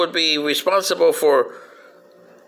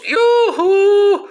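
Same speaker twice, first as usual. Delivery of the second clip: high loud yoo-hoo whoop; 5 dB louder than usual. Acted. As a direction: let out a gleeful yoo-hoo. Cheerful whoop after history point.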